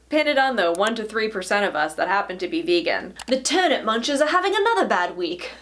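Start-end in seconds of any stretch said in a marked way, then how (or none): 3.3-5.5 higher-pitched posh British article reading; posh British accent. Acted. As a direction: put on an upper-class British accent. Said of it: "my poshest British accent".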